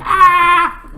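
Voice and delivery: sharp high yelp; high pained cry. Retold or imitated. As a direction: yelp as if blasted. Character hit by blast.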